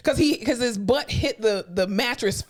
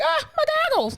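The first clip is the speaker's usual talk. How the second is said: put-on high old man squeak. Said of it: "and he's like".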